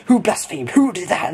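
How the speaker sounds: high, indignant quick-fire questioning; leader demanding answers. Retold or imitated. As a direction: imitate a cross group leader hunting the culprit. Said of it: "the leader of the pilgrimage said".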